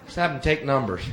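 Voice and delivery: usual speaking voice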